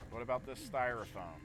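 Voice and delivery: ordinary talk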